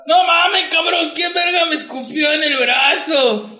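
high-pitched indignant shouting; retold outrage. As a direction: imitate someone yelling after being spat on. Retells Shea's outrage at being spat on.

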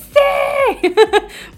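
carcajada alta y aguda; risa aguda. Real, not acted. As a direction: suelta una risa aguda de emoción. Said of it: "risa tras contar que gritó". (risa aguda emocionada)

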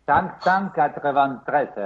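"cinq cent quatre-vingt-treize" said slowly in French. Shown as a demonstration. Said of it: "el quinientos noventa y tres en francés". ("cinq cent quatre-vingt-treize" en francés, despacio)

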